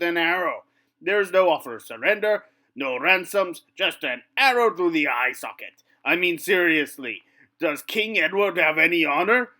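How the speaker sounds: loud, outraged French knight; indignant rant. Acted. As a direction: rant about lost honour. French knight rants against longbows.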